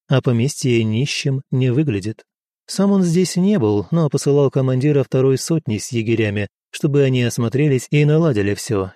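usual speaking voice

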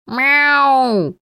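long, high, loud meow; yowling cat. A narrator's reading. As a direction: yowl like a cat calling from afar. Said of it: "Уорхол с земли орал". (истошное мяуканье)

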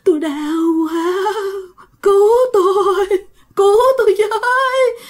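high wailing cries for help; ghostly moaning.

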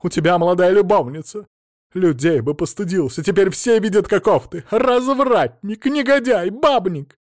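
high, loud, outraged scolding; screaming tirade. A narrator's reading. Mother-in-law berates her cheating son-in-law, outraged screaming. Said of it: "тут же завопила тёща".

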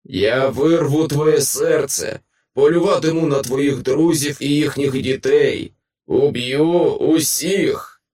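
loud, drawn-out monstrous threat; victorious creature roaring menace; triumphant monster howl. A narrator's reading. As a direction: bellow threats like a gloating monster. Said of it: "завив монстр переможно".

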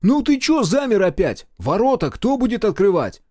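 loud, high call; shouted scolding. A narrator's reading. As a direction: yell to the mechanic out the window. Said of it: "крикнул слесарю".

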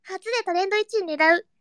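ordinary talk, nothing marked

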